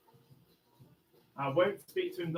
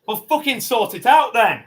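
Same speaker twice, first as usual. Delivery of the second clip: loud, high, furious shout; angry shout; 5 semitones higher, 11 dB louder than usual. Real, not acted. Barricaded man yelling at the police negotiator, furious shout. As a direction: snap at them, out of patience.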